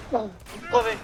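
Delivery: high, loud panicked cry; wailing in alarm; panicking gamer. Real, not acted. Losing fight in game.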